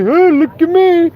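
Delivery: mocking de Blasio as a high, dopey doofus; tall doofy guy. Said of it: "tall and doofy".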